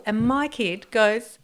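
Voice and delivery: usual speaking voice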